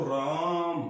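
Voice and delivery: राम with its आ held long and slow; drawn-out Raam. Shown as a demonstration. Teacher demonstrating a stretched राम to the class. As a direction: pull राम out like a chant. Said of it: "लंबा खींचता बोलो".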